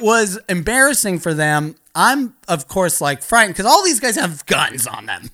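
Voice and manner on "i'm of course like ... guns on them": ordinary talk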